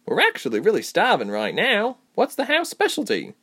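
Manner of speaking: high-pitched, eager interruption; cutting in. A narrator's reading. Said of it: "Reshma cut in".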